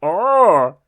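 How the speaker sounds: high, drawn-out cartoon duck voice; Dinky the Duck. Storyteller voicing a duck finding a ladder, silly high voice. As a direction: groan it high in a silly put-on voice. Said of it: "don't like Dinky's voice".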